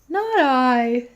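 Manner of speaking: loud, mock-yawned reply; yawning. A narrator's reading. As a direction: yawn through the words.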